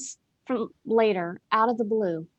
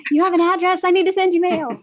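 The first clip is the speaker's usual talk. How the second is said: much higher and louder, bubbling over; thrilled higher, louder burst on hearing a friend has a mailbox; gushing excitement; 9 semitones higher, 11 dB louder, faster than usual. Retold or imitated. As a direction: squeal it like great news just landed. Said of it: "I was so excited".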